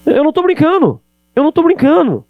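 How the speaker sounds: high, loud insistence; high-pitched emphatic protest; insistent. Real, not acted. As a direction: swear you mean it, loudly. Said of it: "emphatic insistence".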